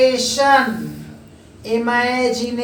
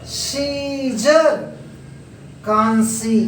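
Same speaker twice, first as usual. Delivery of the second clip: each example word drawn out slowly; teacher modelling the long ई sound slowly; slow word drill; 2 semitones higher, slower than usual. Shown as a demonstration. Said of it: "teacher reads example word list".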